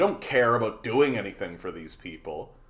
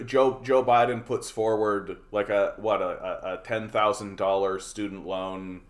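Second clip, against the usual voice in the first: ordinary delivery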